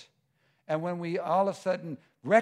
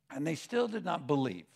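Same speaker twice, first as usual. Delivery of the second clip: usual speaking voice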